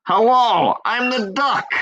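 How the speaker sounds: high, loud cartoon duck greeting; high cartoon duck saying hello; silly duck voice. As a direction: squawk it out like a cheerful toy duck. Re-recording the duck voice.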